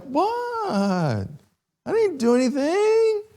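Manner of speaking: high indignant whine; mock Israel acting innocent; hammed-up whiny denial. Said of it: "Insert your own whiny voice there".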